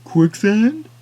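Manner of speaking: put-on loud, high chiming-in old man; old husband. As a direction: pipe up with a guess. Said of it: "Her husband chimed in".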